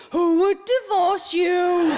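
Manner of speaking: hammed-up doting pity; mock pity. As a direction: coo it with fake pity. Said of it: "It's like somebody saying to me".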